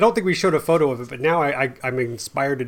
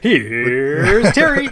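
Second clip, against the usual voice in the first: announcer intro; 3 semitones higher, 8 dB louder, much slower than usual. Acted. Introducing a comic act.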